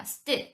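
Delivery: 스트 breathed with a tense t; whispered st. Shown as a demonstration. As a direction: breathe 스트 with a tight t. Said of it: "스트 발음이 돼요". (스트를 속삭이듯 된소리로)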